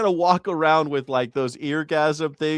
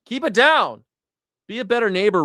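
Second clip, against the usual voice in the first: raised, higher-pitched scolding; exasperated call; 6 semitones higher, 4 dB louder than usual. Pretend. Griper telling religious people off, exasperated and loud. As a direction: call out to noisy neighbours.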